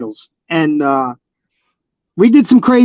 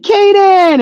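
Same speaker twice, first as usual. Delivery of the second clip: imitated little girl calling out, much higher; high, drawn-out shout of the name; child's excited call; 12 semitones higher, 3 dB louder than usual. Child greets him in story.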